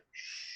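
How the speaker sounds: very soft, long "shh"; hushing. Acted. Owl answering the monkey's shouting, hushing. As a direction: shush gently and at length.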